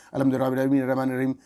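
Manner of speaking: hurried, swallowed Arabic recitation; rushed recitation. Shown as a demonstration. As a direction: gabble the verse carelessly. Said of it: "اس طرح بھائی، الحمد نہیں ہوتا". (جلدی جلدی بے پروائی سے پڑھنا)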